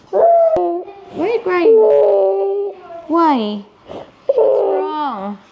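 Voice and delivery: high, drawn-out cooing questions. Real, not acted. Comforting a crying child.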